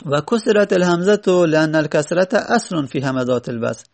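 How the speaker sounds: ordinary talk, nothing marked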